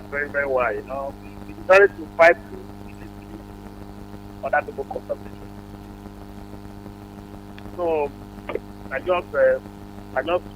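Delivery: faint voice on a bad phone line; fading away from the mic; barely audible. Real, not acted. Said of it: "but you sound a bit faint".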